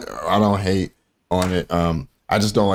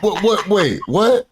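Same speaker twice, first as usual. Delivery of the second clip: stunned disbelief; 13 semitones higher, much slower than usual. Retold or imitated. The lady told there are unicorns in the Bible, stunned. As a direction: sputter in total confusion.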